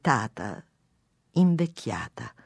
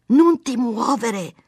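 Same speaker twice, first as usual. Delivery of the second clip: raised, drawn-out command to keep still; high, slow, insistent order; firm order; 8 semitones higher, 8 dB louder, much slower than usual. A narrator's reading. Wife squeezing her husband's blackhead, firm order.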